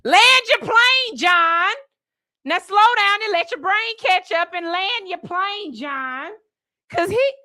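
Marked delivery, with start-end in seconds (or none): 0.1-6.3 high, slow mock lecture; imitating the stammering dad scolding John; imitating him. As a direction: play the angry dad, drawn out. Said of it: "Could you imagine this man".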